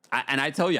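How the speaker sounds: normal talking voice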